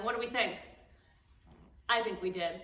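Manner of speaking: ordinary delivery, nothing marked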